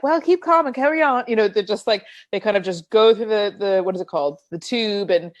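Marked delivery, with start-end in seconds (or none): 0.0-1.2 lightly voiced unfazed local, higher pitched; a hint of stiff-upper-lip Londoner; British stoic. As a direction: imitate people calmly carrying on. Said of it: "the people are just like".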